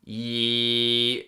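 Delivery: loud held E; twanged E. Shown as a demonstration. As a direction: sing the E with twang.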